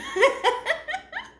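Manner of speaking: loud squealing laugh, very high; high delighted laugh. Real, not acted. Delighted with her own cooking, squealing high laugh. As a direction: burst into a high gleeful laugh.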